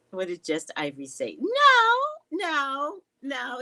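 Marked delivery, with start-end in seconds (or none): 1.4-3.6 very high, loud no; emphatic no. Real, not acted. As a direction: shout it down at once. Rejecting a chat suggestion.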